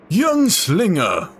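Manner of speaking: grand archaic voice. Acted. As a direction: proclaim it like an ancient oracle. Voicing archaic NPC.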